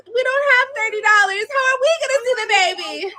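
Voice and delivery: high-pitched mimicry of whiny complainers; mocking whine. Streamer imitating upset broke followers, high mocking whine.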